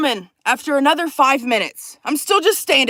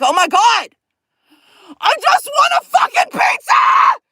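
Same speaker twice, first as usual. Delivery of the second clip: very high, loud, drawn-out scream; hammed-up shriek of hungry desperation; screamed meltdown; 11 semitones higher, 6 dB louder, slower than usual. Customer just wants a pizza, screaming in frustration.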